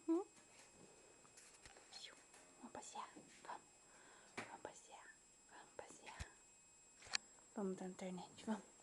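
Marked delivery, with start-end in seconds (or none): 2.6-6.3 soft whisper to the dog. Real, not acted. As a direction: whisper it to the pet.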